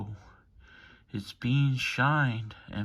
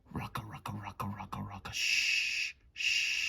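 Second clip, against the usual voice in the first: lulling whisper; 4 semitones lower than usual. Acted. Lulling listeners to sleep, whispered rocka shh. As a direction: hush it like rocking a baby.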